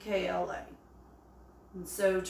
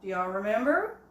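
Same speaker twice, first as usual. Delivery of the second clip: normal talking voice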